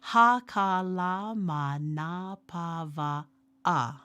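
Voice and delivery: "Ha ka la ma na pa wa a" each syllable slow and separate; teacher modeling syllables; slow syllable drill. Shown as a demonstration. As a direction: spell out each syllable slowly for learners to repeat. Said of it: "e ho'opili mai, which means to repeat after me".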